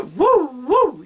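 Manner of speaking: two high, loud whooped woos; baby-bouncing woo. Shown as a demonstration. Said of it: "Along with the bouncing baby".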